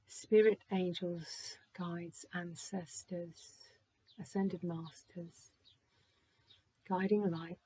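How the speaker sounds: hushed ritual summons; calling on spirit. Acted. Invocation to spirit and guides.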